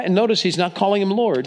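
ordinary delivery

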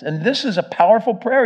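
ordinary talk, nothing marked

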